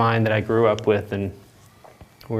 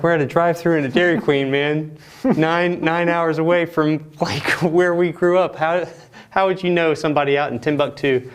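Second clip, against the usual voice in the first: retold disbelief; 7 semitones higher, 3 dB louder than usual. His own retold disbelief.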